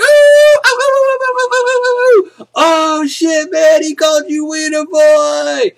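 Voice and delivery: very high, loud, stretched oohs; whooping class mocking the kid; classmates hooting. Classmates egging on after the teacher's insult, wild hooting. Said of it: "Everyone's like".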